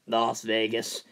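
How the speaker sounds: low, soft croaking frog. Acted. Golfer hears a frog on the green, low soft frog voice. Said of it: "Frog says".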